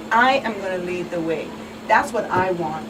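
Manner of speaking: normal talking voice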